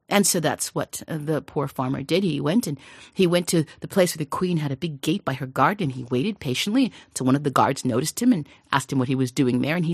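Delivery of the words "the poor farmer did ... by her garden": usual speaking voice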